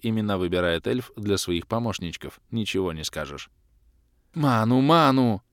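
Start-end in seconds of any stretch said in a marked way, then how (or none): 4.3-5.4 slow, loud, high call for mana; urgent demand. Acted. Kalen asking for mana, urgent call. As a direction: demand it again.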